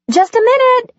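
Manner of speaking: yelling back. Acted. Mother yells back to her son across the house, loud. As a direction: call back loud to the front door. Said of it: "she yelled back".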